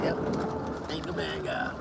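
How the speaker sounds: ordinary delivery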